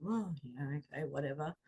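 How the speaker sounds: quiet sarcastic brush-off; a soft, unimpressed whatever; eye-rolling dismissal. Retold or imitated. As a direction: roll your eyes at the cliché. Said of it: "I'd eye roll".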